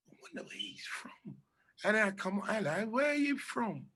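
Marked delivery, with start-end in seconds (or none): 0.1-1.4 hushed unvoiced whisper; whispered aside. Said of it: "mimic people". 2.9-3.9 higher, put-on curious voice; lightly voiced self asking a stranger; mock friendly question. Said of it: "ability to mimic people's talking".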